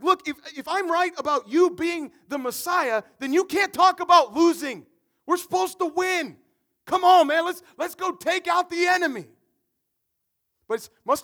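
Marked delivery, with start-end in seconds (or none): 0.0-9.3 loud, high, insistent rebuke; pushy Peter. Retold or imitated. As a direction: push hard like a frustrated friend. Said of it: "He's like".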